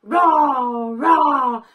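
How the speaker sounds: loud, playful roar; dinosaur roar. Shown as a demonstration. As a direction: roar big for the kids to copy. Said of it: "Let's all roar like the dinosaur".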